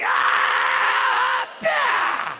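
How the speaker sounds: very high, long strained scream; mock climax scream. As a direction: scream through a huge effort, hammed up. Acting out a long-awaited climax.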